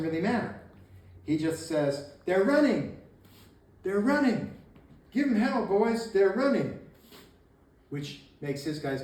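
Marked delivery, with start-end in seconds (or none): 2.2-6.8 high, loud acted battle cry; rallying shout. Leader runs uphill to rally his men, acted shout. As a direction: holler it to the troops, pausing between cries.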